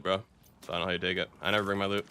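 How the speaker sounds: ordinary delivery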